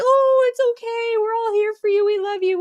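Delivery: hammed-up saccharine reassurance; mock soothing. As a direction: coo it like an overdone caretaker. Mocking adults soothing a crying kid.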